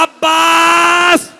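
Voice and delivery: slow, high, sung wail; weeping lament. Acted. Zainab crying out to Abbas, slow sung wail. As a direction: wail the sister's grief toward the river. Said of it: "रो के कहा".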